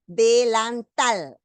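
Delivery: "delantal" stretched out slowly, de-lan-TAL; teacher's slow demo of "delantal" with the final syllable stressed; stress on last syllable. Shown as a demonstration. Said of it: "la mayor fuerza de voz está en la última sílaba".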